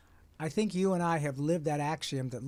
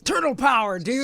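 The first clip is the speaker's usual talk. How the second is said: loud, high surfer-dude turtle shout; Raphael voice; 10 semitones higher, 12 dB louder than usual. Acted. Voice actor gives a fan the Raphael catchphrase, loud and high.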